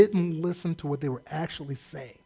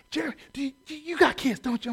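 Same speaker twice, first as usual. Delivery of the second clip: mock prophetic probing; 6 semitones higher than usual. Stranger probes in story.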